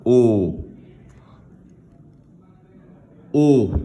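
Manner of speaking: a long, clear "O"; isolated sound. Shown as a demonstration. Teacher giving pupils the last sound, held "O". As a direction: hold the vowel "O" on its own. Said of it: "c'est le son O".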